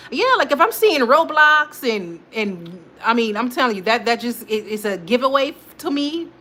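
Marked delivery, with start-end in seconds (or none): none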